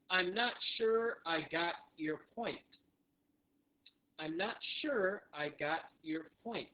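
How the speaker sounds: slow, evenly spaced words; deliberate, carefully separated teaching delivery; slow phrase model. Shown as a demonstration. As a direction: model it slowly for learners. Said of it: "All right, so one more time".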